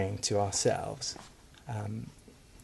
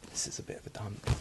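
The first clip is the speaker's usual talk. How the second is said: whispered aside; 11 dB quieter than usual. Retold or imitated. Retold first thought of Woking.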